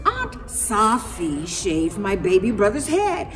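usual speaking voice

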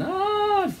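very high, thin mock screams; distant screaming. A narrator's reading. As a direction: imitate a panicked crowd far away. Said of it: "people screaming and shouting".

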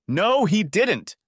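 high, slow, shrill denial; shrill it out, drawing it out. A narrator's reading. Shannon shrilling at Darren in denial, high and slow. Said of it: "Shannon shrilled".

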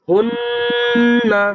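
হুন্না with the ন্ন hummed long through the nose; nasal ghunna. Shown as a demonstration. Teacher demonstrates ghunna on হুন্না for students. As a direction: hold the nasal hum in হুন্না. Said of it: "গুন্নাহ করে পড়তে হবে".

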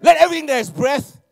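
loud, high mock announcement of scripture; loud joking pulpit call; mock proclamation. Shown as a demonstration. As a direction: boom it out like a preacher, high and loud. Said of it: "Sorry, uh, what Bible did I read?".

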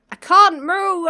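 sudden loud, high cry of alarm. Real, not acted. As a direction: blurt it out in panic. Stuck in the maze, panicking.

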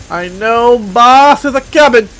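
slow, stretched-out foreboding; slow, deliberate announcement; drawn out. Real, not acted. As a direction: draw out BOSS and COMING. Same line repeated drawn out.

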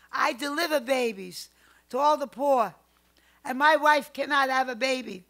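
doctor complaining to the grandmother; anguished outburst. Retold or imitated. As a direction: cry out at the unfairness.

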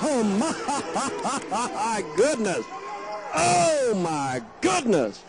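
slow, laughing oh my goodness; stunned delight with a laugh; amazed. Real, not acted. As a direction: marvel at the shot, drawn out. Reacting to thirty-foot three.

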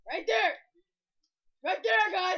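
loud, emphatic call-out; insistent shout. Real, not acted. Player shows viewers the spot, insistent shout. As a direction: punch it out loudly to the viewers.

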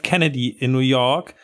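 ordinary talk

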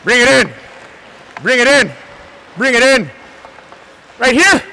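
halftime pep speech. Real, not acted. Coach calling players in.

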